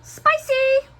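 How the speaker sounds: high, loud mock-excited squeal; playful exclamation. Camerawoman joking on a dog called Chili, playful high shout. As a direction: call it out like a hot-chili joke.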